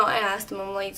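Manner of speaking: normal talking voice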